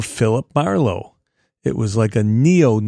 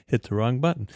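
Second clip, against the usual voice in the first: normal talking voice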